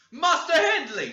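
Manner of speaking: very loud, high, slow shout. Acted. Joseph shouting for the master to come, very loud and high. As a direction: call out across the house at full pitch. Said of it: "shouted our chaplain".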